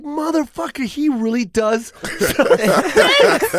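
slow, laughing disbelief; amused disbelief, stretched out. Real, not acted. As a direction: draw it out while laughing.

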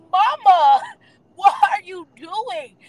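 high, loud cry of disbelief; shocked outburst. Retold or imitated. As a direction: yell it at Mama, stunned and half laughing. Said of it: "I was flabbergasted".

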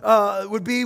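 ordinary delivery

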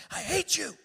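high, loud burst of hatred; imitating a hate-filled yell; seething hatred. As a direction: spit it out loud and hateful. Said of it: "seething hatred".

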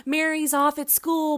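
mock explaining mother. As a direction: chirp it like a TV mom covering. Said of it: "And she's like".

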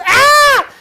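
very high, loud scream; piercing re-enacted scream; imitated scream. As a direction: shriek like the panicked man filming. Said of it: "and screaming".

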